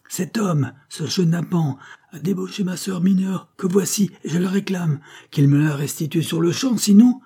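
high-pitched tirade, rising to a loud threat; indignant accusation. A narrator's reading. Fake brother accusing the captain to the policeman, outraged. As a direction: denounce him, climbing to the last word.

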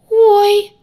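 loud, very high scared squeak; frightened squeak. A narrator's reading. Redhead suddenly startled, high frightened squeak. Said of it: "неожиданно высоким и испуганным голоском пискнула рыжая".

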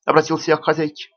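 ordinary delivery, nothing marked

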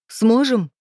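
high, loud, hysterical echo; hysterical shriek. A narrator's reading. Woman doubting her lover's promise, shrieking hysterically. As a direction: throw the word back as a shrill hysterical question. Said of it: "взвизгнула истерично".

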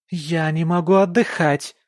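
high, loud shriek; wife shrieking at her husband. Acted. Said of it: "визжит она".

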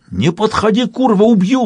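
high-pitched, frantic threat; shriek. A narrator's reading. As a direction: squeal the warning, pitch shooting up. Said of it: "взвизгнул таракан".

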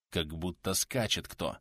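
low, slow, soft observation; hushed listening. A narrator's reading. Bearded man hearing riders in fog, hushed. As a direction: speak softly and slowly, as if listening into fog.

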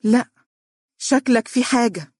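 won't take no for an answer; insistent. A narrator's reading. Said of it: "دنيا بإصرار". (صوت نسائي مرتفع وملحّ)

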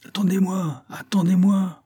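high, urgent call after someone running away. A narrator's reading. The fox calling after the fleeing cat. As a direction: cry out to stop him leaving. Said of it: "en criant".

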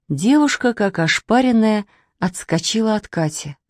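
ordinary delivery, nothing marked

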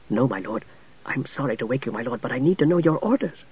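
soft, deferential knight; polite young knight. Acted. Young knight apologizes for waking Tanis, softly. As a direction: speak up respectfully to your lord.

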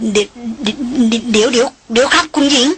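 flustered, muffled stammer; the director stalls the angry noblewoman. A narrator's reading. Flustered man mumbling to hold her off. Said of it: "อู้อี้".

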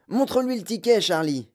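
high, urgent call; excited cry. A narrator's reading. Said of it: "cria grand-papa Joe".

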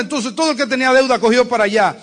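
loud, fast, high preaching; fiery preaching. Acted. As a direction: drive it home to the congregation. Preaching at full force. (predicación fuerte y rápida, con fervor)

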